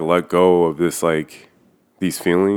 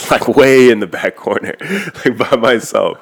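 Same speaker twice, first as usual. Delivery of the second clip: laughing; 6 semitones higher, 5 dB louder than usual. Real, not acted. Laughs recalling sitting in back.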